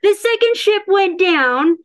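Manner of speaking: can't hold back the laugh. Real, not acted. Laughing mid-story.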